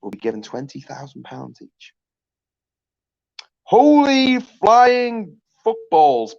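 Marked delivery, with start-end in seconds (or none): 3.6-6.4 high, drawn-out gasp of amazement; astonished exclamation. Real, not acted. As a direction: cry it out in mock awe. Exclaiming at big prize idea.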